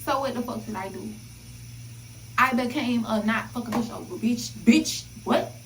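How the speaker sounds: ordinary talk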